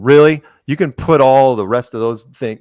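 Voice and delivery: retelling a celebrity's indignant snap; upset outburst. Retold or imitated. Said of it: "she gets really upset".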